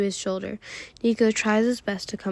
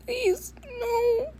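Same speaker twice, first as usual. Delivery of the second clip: much higher, softer, tearful please no; crying plea; 5 dB quieter than usual. Acted. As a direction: beg through tears. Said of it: "see Will crying".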